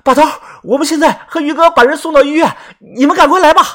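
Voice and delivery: high, loud, urgent phone call; breathless urgency. A narrator's reading. Narrator on the phone to the boss while running, high and urgent. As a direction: shout the news while running. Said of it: "我跑着喘气说".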